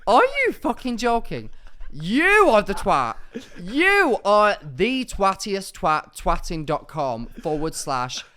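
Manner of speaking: loud, slow mock-furious rant; hammed-up indignant yelling; mock outrage. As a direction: blow up in pretend fury at the insult. Mock outrage at being insulted.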